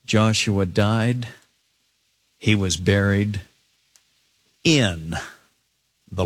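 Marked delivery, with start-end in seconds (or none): none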